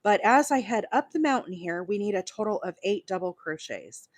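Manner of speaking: ordinary talk, nothing marked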